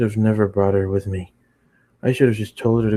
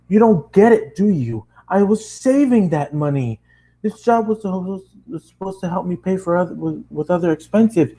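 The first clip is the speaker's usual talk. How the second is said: put-on lecturing the girl angrily about savings; angry rant; 10 semitones higher than usual. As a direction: scold her with mounting frustration.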